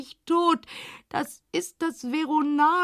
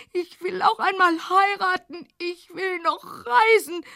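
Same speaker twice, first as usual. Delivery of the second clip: crying; 4 semitones higher, 4 dB louder than usual. Acted. Crying heard.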